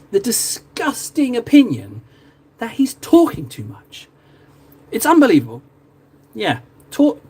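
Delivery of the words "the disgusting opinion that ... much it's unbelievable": mock outrage.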